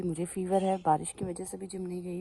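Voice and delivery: ordinary delivery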